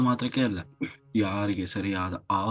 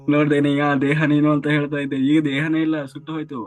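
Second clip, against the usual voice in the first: mocking messenger; 4 semitones higher, 6 dB louder than usual. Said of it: "ಆಗ ಅವ್ರು ಹೇಳ್ತಾರೆ".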